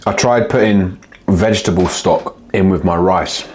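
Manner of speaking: normal talking voice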